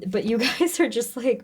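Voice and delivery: ordinary talk, nothing marked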